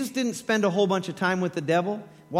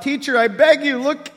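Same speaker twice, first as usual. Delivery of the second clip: pleading cry, higher and louder; desperate father calling out to Jesus; 6 semitones higher, 7 dB louder than usual. Acted. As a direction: beg loudly across a crowd. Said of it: "a man in the crowd called out".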